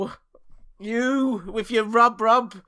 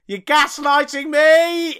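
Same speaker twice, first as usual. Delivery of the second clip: mock outrage; 8 semitones higher, 5 dB louder, slower than usual. Mock accusation in banter.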